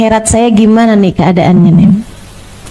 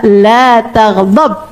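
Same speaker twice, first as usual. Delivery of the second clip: drawn-out, deliberate repeat; slow, measured advice; 2 semitones lower, much slower than usual. Retold or imitated. Prophet repeats his advice, very slow and measured. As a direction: spell it out very slowly. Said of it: "Faraddada miraran faqala".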